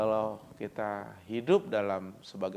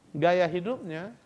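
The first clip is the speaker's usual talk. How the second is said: normal talking voice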